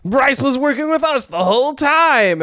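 high-pitched, exaggerated triumph; gloating villain. Demon bragging that Bryce was with him, gloating. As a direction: crow it in a high mock voice.

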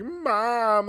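put-on high whiny child calling out "Mom"; mock crying kid. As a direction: imitate a kid from the movie whining for his mother. They're crying to their parents.

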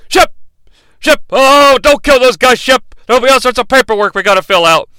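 hammed-up worried dad calling out; mock panicked pleading. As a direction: beg him to stop, half-joking. [name] begging Shep to stop.

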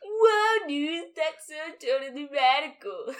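loud, high surfer-dude voice. Pretend. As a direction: play a stoked surfer dude.

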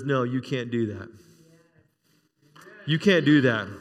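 lightly voiced God, low and firm; the Lord speaking. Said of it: "the Lord looks down and says".

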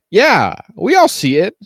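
slow loud sarcastic aside. Real, not acted. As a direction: drag it out dryly. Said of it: "sarcastic retort".

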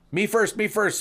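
high, eager squeal; eager clerk. Acted. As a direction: jump in first like an overeager kid. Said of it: "said the admin clerk".

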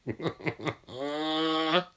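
high, rising cackle; real giggle climbing into a long squeal; gleeful laugh. Real, not acted. Laughing at the captain's broken arm, gleeful cackle.

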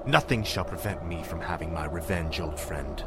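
low, soft, chilling threat; quiet menace; cold menace. Acted.

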